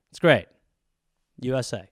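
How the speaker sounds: ordinary talk, nothing marked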